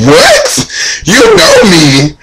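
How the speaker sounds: high squeaky shocked What; shocked disbelief. Retold or imitated. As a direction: voice jumps way up in pure shock. Brandy says she knows her.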